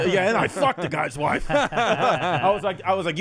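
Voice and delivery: laughing through the words; giggling at his own punchline; cracking up mid-sentence, slowed down. Real, not acted.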